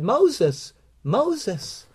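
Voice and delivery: MOSES called twice, slowly and deliberately; solemn double call of MOSES; emphatic name call. Retold or imitated. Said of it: "way of showing emphasis".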